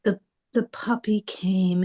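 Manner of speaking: ordinary delivery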